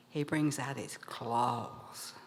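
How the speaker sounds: slow, low, hushed narration; suspenseful hush. A narrator's reading. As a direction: draw it out in a low hush, building menace.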